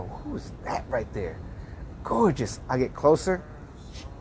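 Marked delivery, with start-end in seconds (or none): 0.0-1.4 hushed, low musing to himself; quiet, private wondering; inner thought. Retold or imitated. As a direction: think it under your breath, much softer. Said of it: "In my brain, I go".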